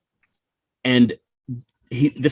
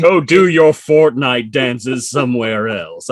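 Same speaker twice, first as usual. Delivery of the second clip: put-on slow, louder shooing of the kids; mock grumpy adult; 2 semitones higher, 6 dB louder, slower than usual. Adult shooing kids off TikTok, mock grumpy and slow. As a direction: wave the kids off like a cranky grown-up.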